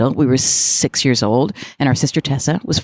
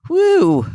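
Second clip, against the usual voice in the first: high excited woo. Real, not acted. Reacting to money memory question.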